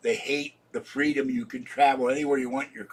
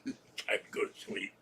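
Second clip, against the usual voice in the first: whispered sleepy inner voice; body's voice; 3 semitones lower, 8 dB quieter than usual. Pretend. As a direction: whisper it like your body talking. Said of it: "My body".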